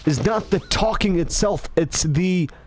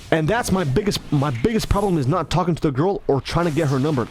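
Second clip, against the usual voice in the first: normal talking voice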